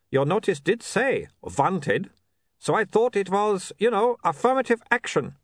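put-on light Uberwald vampire accent, formal and eager; a keen young vampire from Uberwald, lightly voiced; Otto's Uberwald accent. A narrator's reading. Otto explains the notice.

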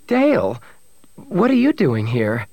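high cry on the name; startled surprise. A narrator's reading. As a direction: exclaim high, then question him. Bria startled by newcomer.

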